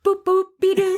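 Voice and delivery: high squeaky boop-boop-be-doo; Betty Boop voice. Pretend. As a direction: sing it like a cartoon flapper, high and loud. Betty Boop song tag.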